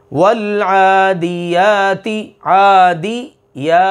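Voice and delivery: वल आदियाति slow with a long madd; correct tajweed demo. Shown as a demonstration. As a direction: stretch the alif in आदियाति. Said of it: "ये तरीक़ा।".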